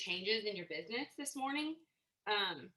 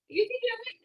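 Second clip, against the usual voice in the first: even higher, louder call of belief; rallying cheer; 13 semitones higher, 6 dB louder, faster than usual. Real, not acted. A coach urging nervous sellers on, in a soaring excited cheer. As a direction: really sell it with a soaring push.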